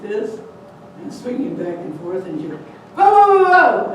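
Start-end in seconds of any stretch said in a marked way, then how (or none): 3.0-3.9 much higher and louder barks; mother barking even louder at the cat. Acted. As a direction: bark hard to chase the cat off. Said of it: "even louder than the first".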